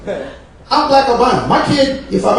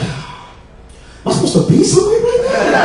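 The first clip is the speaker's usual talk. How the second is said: high, drawn-out squeaky question; comically puzzled, pitched way up; mock bewilderment; 13 semitones higher, slower than usual. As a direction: ask it slowly in a small high voice. Acts out his bewildered reaction.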